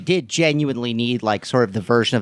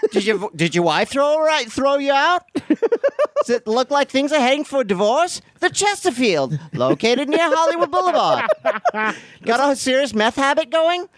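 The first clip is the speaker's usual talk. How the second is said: put-on mid-Atlantic accent; 13 semitones higher than usual. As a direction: sell the hotel like a 1920s ad. Old-timey hotel ad bit.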